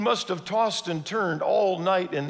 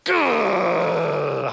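angry cry; 6 dB louder than usual. Acted. Jesus angry at disciples.

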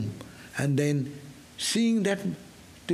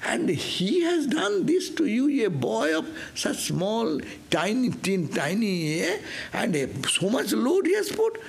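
dismayed wife; 7 semitones higher than usual. Retold or imitated. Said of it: "She said".

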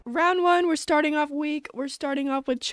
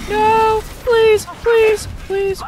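high, loud desperate pleading; loud begging; 8 semitones higher, 10 dB louder, much slower than usual. Real, not acted. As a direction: beg the game to spare you. Begging not to die.

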